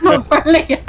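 laughing. Real, not acted. Echoing a friend's alarm.

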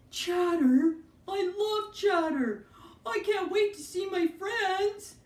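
high, slow, eager puppet; excited puppet voice. Acted. Bob puppet voice.

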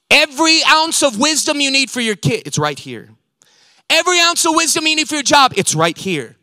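loud, high build on every, dropping on right here; full-force preaching. Acted. Preacher pressing wisdom on parents and workers, loud and rising. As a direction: hammer each every ounce then land it.

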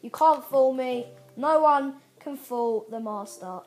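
loud, cocky taunting; gloating. Real, not acted. Gamer dodging a tripwire, loud cocky gloat. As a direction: taunt the game like you outsmarted it.

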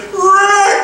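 a high, loud shout of the name; calling out. Retold or imitated. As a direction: yell for the deckhand across the boat. Captain calls deckhand, loose wheel.

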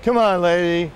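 high-pitched mock yell at normal volume; lightly acted drunk, pleading with the singer; drunk heckler. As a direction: badger her like a tipsy audience member. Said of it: "Again he yells".

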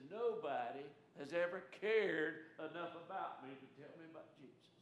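put-on a grateful boy's soft, tearful voice; tearful thanks. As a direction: say it barely above a breath, moved to tears. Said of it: "He's crying by now".

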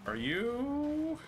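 high incredulous question; surprised. Real, not acted. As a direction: rise up in disbelief.

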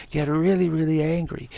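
half-joking, punch the anger up; mock angry. Shown as a demonstration. Urging listeners to call the Dharma Kings, mock angry shout. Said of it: "Maybe we should get more angry".

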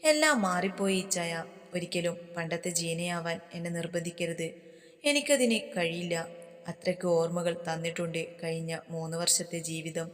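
plain reading voice

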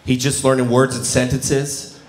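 normal talking voice

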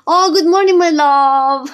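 high, loud, delighted hello; excited greeting. Real, not acted. To a viewer arriving, loud excited greeting. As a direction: beam a big hello to a friend.

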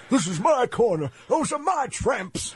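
slow, high put-on voice; imitating pimp. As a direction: drawl it out like a sleazy boss. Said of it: "He came over and goes".

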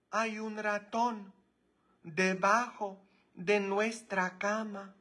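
high, slow falsetto, dropping soft; high hammed-up maid voice; mock maid's squeaky panic.

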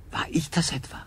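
stunned whispered question; appalled whisper. Acted. Adrian whispering, horrified at what he did. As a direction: whisper it in dawning guilt.

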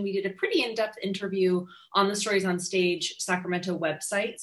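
usual speaking voice

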